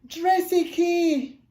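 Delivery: a high, loud, slow call across the room; joyful shout. Retold or imitated. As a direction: shout the inside joke out loud, stretched. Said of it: "shouted my friend".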